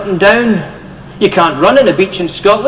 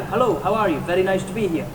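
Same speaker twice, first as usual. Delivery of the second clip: Scottish accent; 11 dB quieter, faster than usual. Shown as a demonstration. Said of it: "This is a Scottish person talking".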